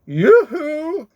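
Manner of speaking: high, loud sing-song yoo-hoo; calling out. Acted. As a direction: call through the door brightly. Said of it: "he called".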